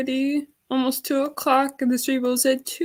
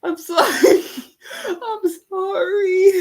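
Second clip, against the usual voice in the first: tearful apology, higher and louder; real crying; 6 semitones higher, 3 dB louder than usual. Real, not acted. Streamer sorry for crying on stream, tearful. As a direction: laugh and cry while apologizing. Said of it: "I'm crying".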